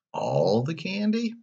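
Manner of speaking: "All the candy" slow, high, climbing question; "All the candy" drawn out, rising in wonder; wondering echo. A narrator's reading. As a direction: stretch "All the candy" in awe. Boy echoes in wonder.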